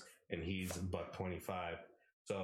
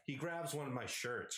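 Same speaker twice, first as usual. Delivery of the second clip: ordinary delivery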